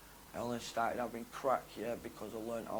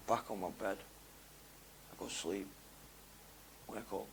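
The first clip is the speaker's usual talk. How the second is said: ordinary talk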